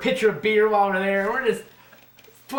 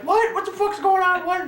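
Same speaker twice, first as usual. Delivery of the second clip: high, loud startled what; jolted awake and baffled; startled dad; 14 semitones higher, 4 dB louder than usual. Retold or imitated. Dad woken at night by police at the door, startled.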